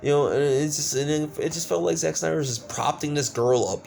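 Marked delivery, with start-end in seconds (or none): none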